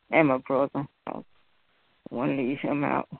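normal talking voice

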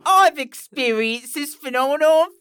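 hammed-up British pomposity; pompous British reviewer. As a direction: read it as an absurdly grand British critic. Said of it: "is this a pompous British person writing this review?".

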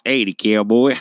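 hammed-up Southern cowboy. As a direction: imitate someone doing a bad cowboy accent. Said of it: "They always decide to do cowboy voices".

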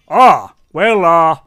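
loud, lightly voiced big man; a hesitant big man starting loud; muscular man. Muscular man asked about the match, awkwardly hesitant. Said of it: "The very muscular man just goes".

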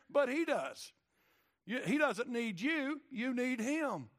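preaching at full force. Acted. Preacher driving home God's knowledge to the congregation, building loud.

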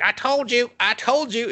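high-pitched parrot impression; mouthy bird. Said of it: "The bird's like".

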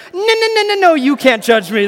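silly high falsetto for a huge man, loud and protesting; squeaky huge guy. Acted. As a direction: play the big guy with a tiny squeaky voice. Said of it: "that's probably not how he would sound".

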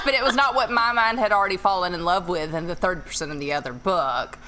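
ordinary delivery

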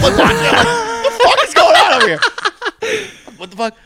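high, loud, laughing exclamation; laughing disbelief. Real, not acted. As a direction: crack up while retelling your shock. Retelling own shock while laughing.

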